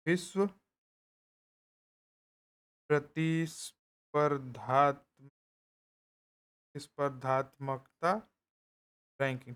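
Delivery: drawn-out syllables while writing on the board; slow dictation. Shown as a demonstration. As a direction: stretch out the long word as you write it. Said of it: "dictating the hard term slowly". (लिखते हुए लंबा शब्द धीरे-धीरे खींचकर बोलना)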